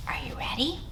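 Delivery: soft unvoiced whisper. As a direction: hush it like a secret. Librarian whispers to kids.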